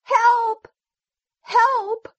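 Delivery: very high sheep cry for help; sheep yelling. A narrator's reading. Sheep in danger from the wolf, very high yell. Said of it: "Then the sheep yelled".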